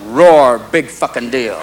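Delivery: low, unimpressed caged lion; jaded lion. Acted. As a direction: roar half-heartedly, dropping low. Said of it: "You see the lion in his cage going".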